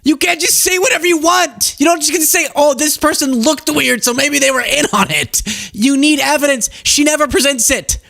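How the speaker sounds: high-pitched exasperated rant. Real, not acted. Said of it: "I'm ranting at the world".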